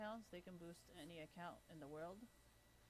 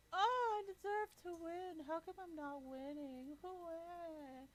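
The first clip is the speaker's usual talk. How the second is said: high, drawn-out whiny imitation; loud, exaggerated mocking wail; mock whining; 11 semitones higher, 8 dB louder, slower than usual. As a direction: whine and moan slowly like a sore loser. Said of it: "All you do is whine and moan".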